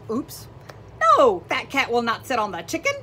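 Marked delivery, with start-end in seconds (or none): 1.0-1.4 high, loud cry of no. Acted. Teacher protesting in the story, loud high no. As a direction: protest for the kids.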